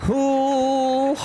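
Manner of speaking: high, delighted ooh; a thrilled ooh up high; excited ooh. Real, not acted. Streamer eyes a pricey coaster, delighted high ooh.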